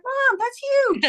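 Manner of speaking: very high, drawn-out daughter voice; imitated daughter, wowed and slow; amazed daughter. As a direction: stretch it out in high-pitched amazement. Daughter amazed at mother's story.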